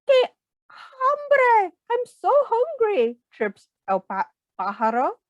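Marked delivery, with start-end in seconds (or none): 0.1-3.2 high, crying little bird voice; weeping bird. Acted. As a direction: sob it out high and thin like a starving bird. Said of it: "a weeping bird".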